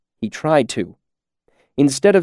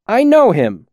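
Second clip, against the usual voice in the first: high, louder accusation; raised, angry outburst; angry recognition; 10 semitones higher, 5 dB louder than usual. A narrator's reading. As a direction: blurt it out in indignation. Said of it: "Chronicler's expression was angry".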